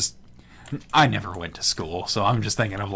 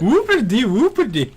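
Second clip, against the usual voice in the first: mock; 12 semitones higher, 7 dB louder than usual. Mocking the gamer's boast.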